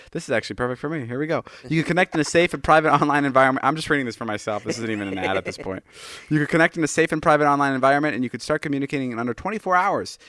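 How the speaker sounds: upbeat host ad read, steady and clear; sponsor ad read. Acted. Host reads a therapy sponsor ad to listeners, upbeat and clear. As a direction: sell the service to the listeners.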